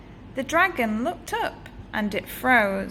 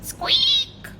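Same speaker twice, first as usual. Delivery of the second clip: tiny squeak, falling. Acted. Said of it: "heard a bone-chilling".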